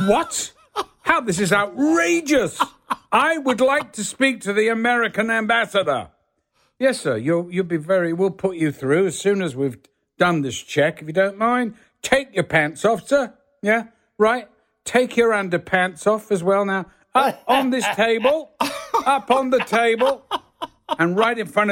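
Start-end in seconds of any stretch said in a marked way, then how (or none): 0.1-6.1 a star protesting at customs, indignant shriek; outraged high protest. Retold or imitated. Danny Kaye ordered to strip, outraged high yelp. 6.8-20.3 mock officious orders. Officer ordering Danny Kaye.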